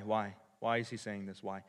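ordinary delivery

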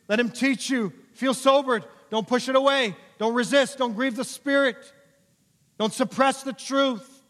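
high, loud, repeated preacher's pleas; full-force exhortation. Real, not acted. Preacher urging listeners to let God work, full-force exhortation. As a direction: urge them on in ringing short phrases.

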